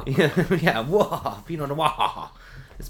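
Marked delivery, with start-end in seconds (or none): 0.8-2.3 "Noir" blown up into a loud, high wah; mock "Noir" with a big open wah; exaggerated dropped R. Mimicking coworker's wine word, "Noir" as exaggerated wah. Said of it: "where she says".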